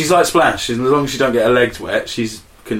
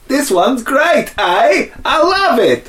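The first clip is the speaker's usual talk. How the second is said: put-on much higher, bouncy Canadian accent; 4 dB louder, slower than usual. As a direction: play up a cheery Canadian accent. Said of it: "I love your Canadian accent".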